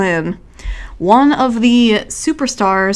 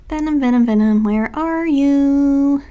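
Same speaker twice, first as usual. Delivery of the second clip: higher, drawn-out mock search call; playful calling out; 5 semitones higher, slower than usual. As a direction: call out for it, stretching the words. Calling for a missing picture.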